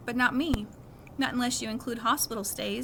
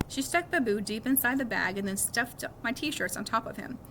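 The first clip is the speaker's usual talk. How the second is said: ordinary delivery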